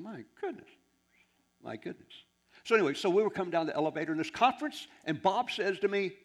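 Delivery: ordinary talk, nothing marked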